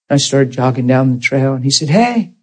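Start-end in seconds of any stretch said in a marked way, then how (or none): 1.9-2.3 a high, sudden call to stop someone. Retold or imitated. Pastor calling after the man jogging away, sudden high call. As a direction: throw a quick high "hey" after him.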